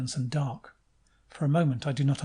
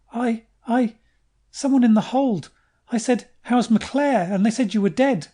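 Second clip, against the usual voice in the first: high, loud and faltering; breathless upset explanation; shaken stammer; 8 semitones higher, 8 dB louder than usual. Acted. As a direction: stumble over it, still in shock.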